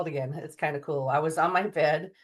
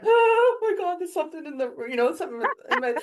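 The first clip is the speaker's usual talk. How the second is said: high, loud, frightened rush of words; retelling a scared outburst at a glowing ball by the bed; panicked gasp; 12 semitones higher, 5 dB louder than usual. Retold or imitated. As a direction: blurt it out in sudden fright, voice jumping up. Sees an energy ball, panics.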